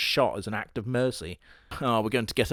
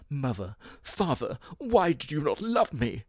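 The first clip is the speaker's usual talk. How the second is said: mock sad plea; 3 semitones higher than usual.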